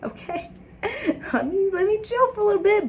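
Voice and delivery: high, laughing "let me chill"; lightly voiced burned-out self; tired sigh of relief.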